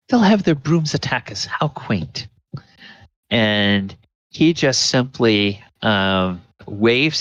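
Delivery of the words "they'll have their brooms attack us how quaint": condescending wizard, pitch sliding down; mocking amusement. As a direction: sneer lightly at a feeble threat. Mordenkainen mocks the women's threat.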